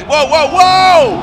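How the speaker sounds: loud drawn-out whoa; excited shout. Real, not acted. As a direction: shout it in shock. Crash during live drift battle.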